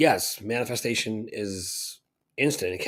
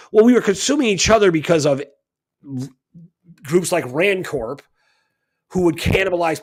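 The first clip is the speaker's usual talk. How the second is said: louder, higher heated rebuttal; heated outburst; 4 semitones higher, 4 dB louder than usual. Real, not acted. Speaker rebutting Rand's blame, heated and louder. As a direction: push back hard on the accusation.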